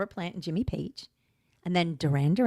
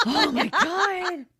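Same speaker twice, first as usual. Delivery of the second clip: gasp, then a high, slow shocked cry; appalled by the joke, voice jumps up; 13 semitones higher, much slower than usual. Real, not acted.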